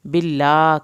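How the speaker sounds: stretched tajweed recitation; Quran recitation. Shown as a demonstration. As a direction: chant it out slowly with a full heavy ṭā. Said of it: "کھڑی زبر کھینچیں گے".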